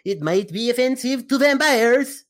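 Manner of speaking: high, loud cartoon vampire accent; Count vampire voice. Podcast host introducing the ad break as a vampire count. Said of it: "I don't know why I do this voice".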